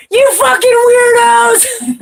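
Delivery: high, slow, drawn-out mock yell. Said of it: "he'd be all".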